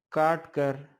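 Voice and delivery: ordinary delivery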